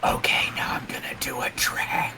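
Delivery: whispering stage magician; breathy mock ASMR whisper, close to the mic; whispered asmr role play. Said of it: "The whispering magician that shuffles cards".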